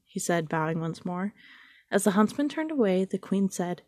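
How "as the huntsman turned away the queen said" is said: plain reading voice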